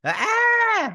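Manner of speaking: sustained high, loud "aah"; high-pitched mock shriek; mock scream. Mimics the scream a second time.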